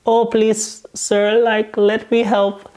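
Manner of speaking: high-pitched, badly imitated Spanish accent. South American lady offering to take over, bad Spanish accent. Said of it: "Spanish kind of, like, accent".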